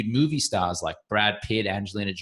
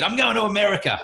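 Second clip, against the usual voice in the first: declaring; 11 semitones higher than usual. Retold or imitated. Heartbroken young man announcing his leap to America, proudly declared. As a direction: announce the big move like he meant it.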